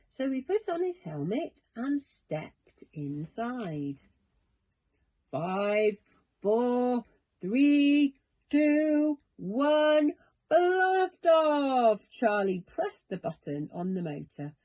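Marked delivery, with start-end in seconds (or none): 5.3-12.1 building countdown, rising to a shout at blast off; excited countdown. A narrator's reading. Boy counting down his rocket launch, excited. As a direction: count down louder and higher to launch.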